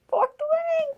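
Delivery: very high laughing squeal. Real, not acted. To viewers, a very high laughing squeal of the title. Holding the book.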